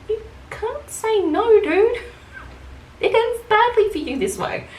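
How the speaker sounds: very high, mock-pleading warning; incredulous comeback. Worker to customer who refuses to leave, high and incredulous. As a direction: squeak it out in amused disbelief.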